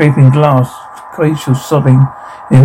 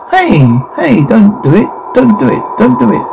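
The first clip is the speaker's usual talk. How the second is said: high, urgent pleading; urgent plea; 6 semitones higher than usual. Acted. Begging Larry and Rachel to stop, urgent plea. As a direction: plead with them not to do it, pitch climbing.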